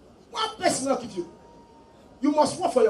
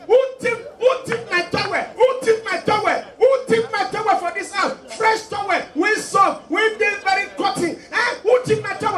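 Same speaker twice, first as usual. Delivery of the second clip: high, loud shrieking complaint about a towel; mock angry yell; 10 semitones higher, 4 dB louder than usual. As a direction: shout it like an outraged hotel guest. Lady yelling about stolen towel.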